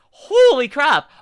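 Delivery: loud, high, drawn-out shock; astonished exclamation. Real, not acted. Streamer reacting to a band bus story, astonished exclamation. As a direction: stretch it out, loud and amazed.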